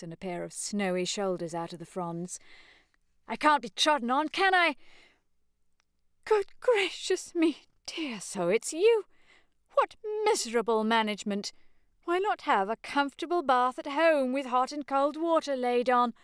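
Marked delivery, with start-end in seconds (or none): 3.3-4.8 put-on high, loud young man's protest; rising indignant question; indignant Freddy. 6.2-16.1 high, fussy scandalised matron; shocked fussy old lady voice; flustered mother. Acted. Mother scolding son.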